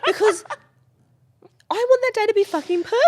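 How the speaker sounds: high, loud and emphatic; intense. Real, not acted. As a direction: passionate insistence. Heated explanation.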